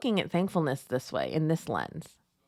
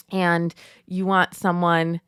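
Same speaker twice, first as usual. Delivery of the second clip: usual speaking voice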